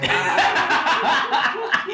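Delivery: high-pitched evil cackle; high villain cackle. Acted. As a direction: cackle gleefully like a mad villain.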